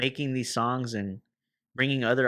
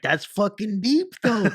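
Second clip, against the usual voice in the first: loud, high, drawn-out reaction; emphatic amazed quote; 10 semitones higher, 6 dB louder, slower than usual. Retold or imitated. Musician retelling his reaction to a friend's question, loudly amazed. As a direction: blurt it high and loud, stretching the words. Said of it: "I looked at her and I was like".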